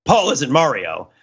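ordinary talk

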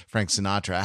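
usual speaking voice

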